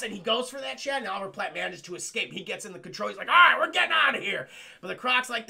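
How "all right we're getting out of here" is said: urgent shout. Retold or imitated. Pilot escaping.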